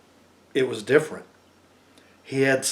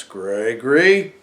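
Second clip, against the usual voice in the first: stern aunt; 3 semitones lower, much slower than usual. Acted. Aunt's stern rebuke.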